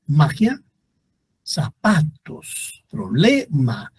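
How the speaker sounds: slow teaching demo. Shown as a demonstration. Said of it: "no se acentúan".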